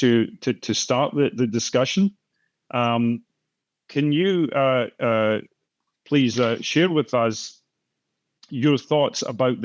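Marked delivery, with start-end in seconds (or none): none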